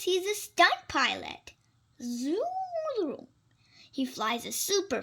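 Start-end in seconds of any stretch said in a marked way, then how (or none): 2.0-3.3 high, drawn-out zoom like a jet; playful jet whoosh. Pretend. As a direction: swoop the word up like a plane. Pretending to fly a jet.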